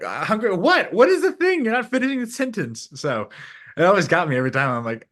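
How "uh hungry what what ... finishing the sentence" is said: much higher, laughing disbelief; mocking his own baffled reply to Kiwis, laughing and high; incredulous mock reply. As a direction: play baffled, pitching way up. Mock confused reply to Aussies.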